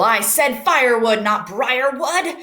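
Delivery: loud, pained outburst, slowing at the end; yelping. Acted. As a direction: snap it out, stung by thorns. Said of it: "he yelped".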